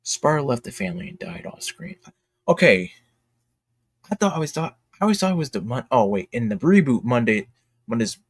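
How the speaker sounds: ordinary talk, nothing marked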